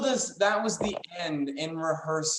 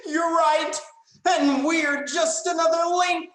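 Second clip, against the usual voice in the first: melodramatic realization; 8 semitones higher, 3 dB louder than usual. Acted.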